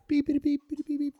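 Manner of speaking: high, chirpy robot beeping; R2-D2 beeps. Retold or imitated. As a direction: beep it out like a little droid. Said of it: "That was my R two rewind".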